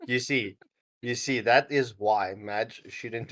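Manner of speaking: ordinary talk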